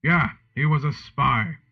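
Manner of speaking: sadly. A narrator's reading. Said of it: "Sirius said sadly".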